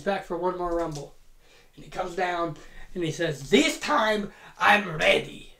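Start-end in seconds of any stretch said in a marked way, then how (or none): 3.5-5.5 confident boast. Retold or imitated. Santino's confident entrance line.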